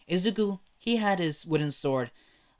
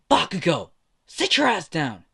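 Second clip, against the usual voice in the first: angry shout; 4 semitones higher, 7 dB louder than usual. Acted. Kendo yelling at Bakugo to stop attacking Izuku. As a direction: slam the order down on him.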